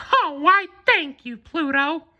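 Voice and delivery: very high falsetto mouse, delighted; excited Mickey. A narrator's reading. Mickey thanking Pluto for the box, high and delighted. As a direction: squeal your thanks like Mickey. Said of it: "Mickey exclaimed".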